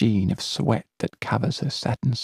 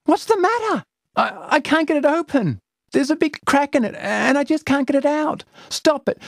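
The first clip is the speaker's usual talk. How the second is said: put-on high, frantic girlish back-and-forth; panicked girls; 14 semitones higher, 4 dB louder, faster than usual. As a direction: argue in rising panic. Two girls panicked exchange.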